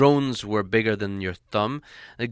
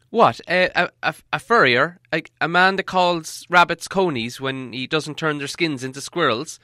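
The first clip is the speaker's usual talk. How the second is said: higher, stammering hobbit voice; flustered hobbit; 5 semitones higher, 5 dB louder than usual. Acted. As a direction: stumble over the words, confused.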